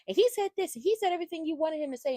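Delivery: high, fast, mock accusing tone; mock angry Lulu. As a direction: imitate Lulu ranting at Brooklyn. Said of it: "talking about".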